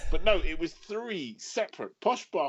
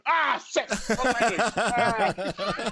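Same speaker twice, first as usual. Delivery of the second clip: sudden dismay; 10 semitones higher, much slower than usual. Real, not acted. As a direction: yelp as if catching your own blunder. Catching own language slip.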